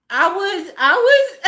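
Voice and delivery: high, squeaky-pitched laughing stammer; laughing too hard. Real, not acted. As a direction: collapse into laughter as you start.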